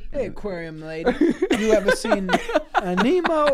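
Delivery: loud, high mock call to the clerk; hammed-up yell at the aquarium lady; loud mock shout. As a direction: belt it out at the lady, mocking. Mocking Grimace's question, shouted.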